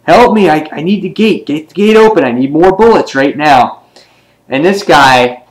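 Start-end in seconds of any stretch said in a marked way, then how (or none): 0.0-3.8 high, urgent mock panic; hammed-up desperate plea, pitched well up; imitated screaming plea. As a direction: act out a frantic call for help. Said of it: "And she's screaming".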